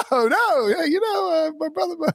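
high, stammering flustered excuse; flustered high-pitched excuse. Retold or imitated. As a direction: squeak out a panicked excuse.

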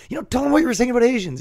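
high, fast coaxing voice; eager prompting. Retold or imitated. Klan recruiter nudging the new guy before the Grand Wizard, high and fast.

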